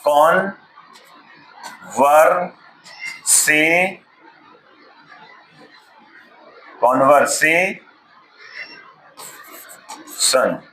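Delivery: Conversation drawn out very slowly, syllable by syllable, ending in a laugh; teacher slowly spells conversation aloud with a chuckle; slow syllable-by-syllable demo. Shown as a demonstration. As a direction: sound out conversation at a crawl for a student. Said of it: "तो हम ऐसे करके सिखाते हैं".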